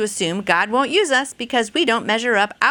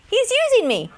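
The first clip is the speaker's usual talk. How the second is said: joyful excitement; 13 semitones higher, faster than usual. Acted. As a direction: jump way up in pitch with delight. Joyful punchline about God using her.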